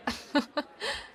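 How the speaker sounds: high, light chuckle; teasing giggle. Acted. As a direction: laugh coyly before dodging the question. Teasing laugh before dodging the question.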